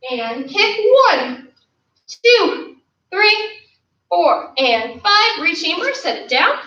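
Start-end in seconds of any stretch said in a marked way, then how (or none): 1.1-5.4 high, spaced coach's count; drill count. Real, not acted. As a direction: call out the beats, stretching the finish. Counting out a held kick.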